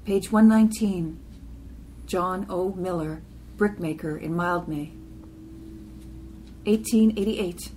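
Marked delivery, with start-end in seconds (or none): none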